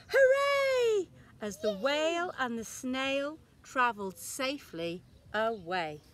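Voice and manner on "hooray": high, loud cheer; cheering shout. A narrator's reading. Villagers cheer as whale swims away, loud cheer. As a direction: shout hooray in joy. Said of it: "villagers shouting".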